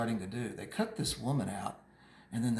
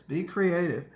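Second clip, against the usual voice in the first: ordinary talk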